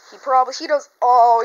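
normal talking voice

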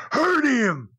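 high-pitched mock yell, one burst; retold scream. Friend yelling from the balcony to the wrestler below, retold scream. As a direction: call it down from far up, imitating a screamer. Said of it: "he screams down there".

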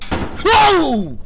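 high, loud falling scream; real startled yell; startled whoa. Real, not acted. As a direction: holler "whoa" at a jump scare. Jump scare in game.

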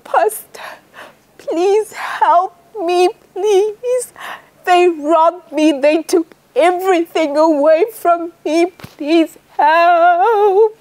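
high, slow tearful pleading; a robbed man begging the pastor, weeping; crying for help. A narrator's reading. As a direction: beg the pastor through tears. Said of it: "And he was crying".

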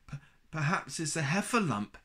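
high, stammering little Piglet voice; alarmed cry. A narrator's reading. Piglet guesses at a scratching sound, alarmed cry. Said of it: "Piglet cried".

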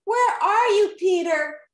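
high, loud call for someone lost. A narrator's reading. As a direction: call across a big space. Calling for Peter in a castle.

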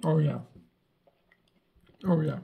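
chewing while talking; muffled crunchy speech; mouth full of chips. Real, not acted. Seen 0: Bit chips at -12 and -9; jaw still working while talking.

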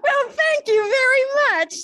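high, loud laugh spilling into slow thanks. Real, not acted. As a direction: thank them through a big laugh. Laughs at interesting answers.

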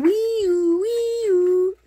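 high, loud wee-oo siren wail; siren imitation. A narrator's reading. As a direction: whoop it up and down like a siren. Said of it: "She laughs at her imitation of a siren".

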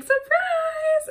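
high, loud cheer; excited shout. Retold or imitated. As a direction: burst out cheering at the driveway. Surprising Tyler's parents.

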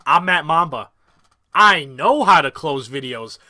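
slow, self-assured brag; smug boast. Shown as a demonstration. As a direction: lay it on, cocky and slow. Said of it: "Oh, I know how to close videos now?".

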